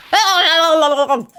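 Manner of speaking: loud high yelp of dismay; a caught-out ugh, loud and high; startled witness grunt. Acted. Witness caught lying at trial, lets out a loud grunt.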